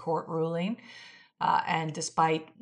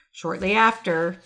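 normal talking voice

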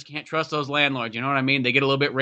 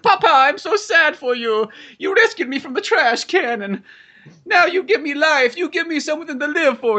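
put-on very high, devoted child voice; little Italian boy; 13 semitones higher, 3 dB louder than usual. Rescued boy consoles his sad papa, high and earnest. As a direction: gush loudly in a squeaky little-boy voice. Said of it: "It's like".

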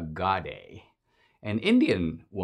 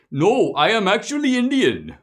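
mock Indian accent, high and loud; a polite immigrant pitching himself, hammed-up high voice; Mister Gadde; 11 semitones higher, 6 dB louder than usual. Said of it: "imaginative rendition".

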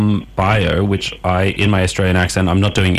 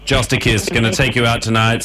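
normal talking voice

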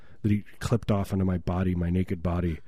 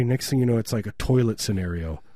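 ordinary talk, nothing marked

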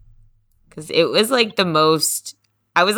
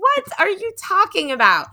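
incredulous; 12 semitones higher than usual. Retold or imitated. Retelling her incredulous reply.

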